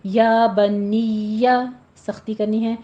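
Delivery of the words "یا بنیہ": slow یا بنیہ with a doubled یا; tajweed demo. Shown as a demonstration. Teacher shows the shadda on یا. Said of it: "یا کے اوپر تشدید ادا کریں گے".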